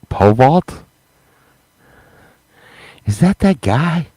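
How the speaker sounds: a hint of the lady squinting in suspicion; mock suspicious lady. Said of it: "and she'd be like".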